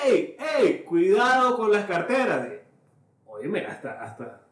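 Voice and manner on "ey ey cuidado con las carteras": imitar un grito de alerta, agudo y fuerte; shouted warning to a crowd, stretched out and high; aviso desde el escenario. Retold or imitated. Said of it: "alguien desde el escenario dice". (grito de advertencia desde el escenario)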